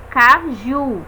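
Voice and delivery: caju silabado, com mais força no JU; tônica em caJU. Shown as a demonstration. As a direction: separe Caju e apoie no JU. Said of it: "da força empregada". (ca-JU, mostrando a sílaba forte)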